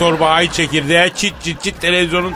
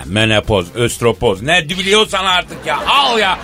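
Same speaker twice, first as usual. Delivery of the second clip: town crier; 4 semitones higher than usual. Acted. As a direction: hawk it like a bazaar crier. S0 opens show like a crier. (tellal gibi bağırarak)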